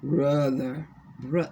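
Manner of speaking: a hint of an African woman's accent, trailing off; African female accent. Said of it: "in their voice".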